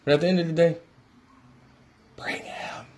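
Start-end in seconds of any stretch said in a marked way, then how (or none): 2.2-2.8 breathy urgent whisper. Real, not acted. A fan whispering his plea to Houston. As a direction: hush it like a secret plea. Pleading with Houston to sign LeBron.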